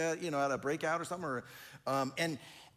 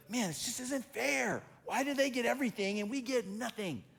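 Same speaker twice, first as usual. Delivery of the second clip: whiny complaint; 7 semitones higher than usual. Retold or imitated. Complaint about unfairness.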